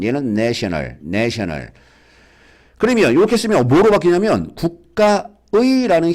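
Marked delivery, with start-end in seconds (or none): 0.3-1.7 내셔널 with a short open "a", as in "nat"; short-vowel pronunciation. Shown as a demonstration. Teacher showing students how 내셔널 is pronounced, short-vowel demo. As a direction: say 내셔널 opening on "nae", no glide. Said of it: "발음할 때는 내셔널".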